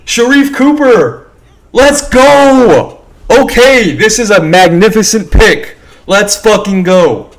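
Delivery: screaming with excitement, very high; frat bro. Real, not acted. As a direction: yell it like your team just won. Celebrating draft pick live.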